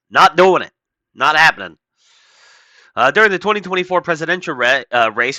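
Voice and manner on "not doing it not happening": firm louder refusal. Real, not acted. Host refusing to pay for the article, louder refusal. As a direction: say it again, louder and flatly final. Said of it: "Sorry, not doing it".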